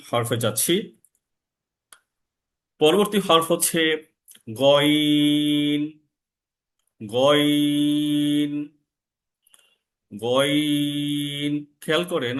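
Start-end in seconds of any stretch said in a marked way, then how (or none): 4.6-11.5 "গইন" drawn out with a slight rasp from the upper throat; long, lightly rasped "গইন"; rasping ghain. Shown as a demonstration. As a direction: let a light harshness grate in "গইন". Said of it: "আমার গলা কিন্তু একটু কর্কশ কর্কশ ভাব আসতেছে".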